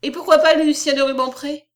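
put-on young poet putting himself forward; bold interruption. A narrator's reading. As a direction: cut in boldly. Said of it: "dit hardiment le poète de province".

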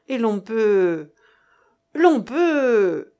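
halting, drawn out and loud; drawn-out hesitation. A narrator's reading. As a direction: grope for the ending, dragging the last word. Speaker hesitates, is cut off.

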